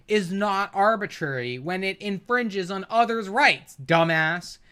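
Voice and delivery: ordinary talk, nothing marked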